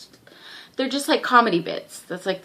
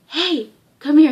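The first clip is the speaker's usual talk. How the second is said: calling over; 7 semitones higher, 8 dB louder than usual. Retold or imitated. Friend calls the boy over.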